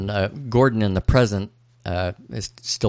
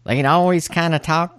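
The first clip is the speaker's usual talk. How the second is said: higher, nasal put-on voice; nasally fake American; 8 semitones higher than usual. Shown as a demonstration. Podcaster mimicking British actors doing American, nasally. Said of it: "a real kind of a nasally voice".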